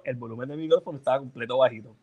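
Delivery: ordinary talk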